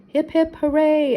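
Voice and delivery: hearty toast cheer. A narrator's reading. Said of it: "everyone cheered".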